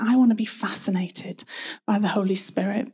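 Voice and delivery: usual speaking voice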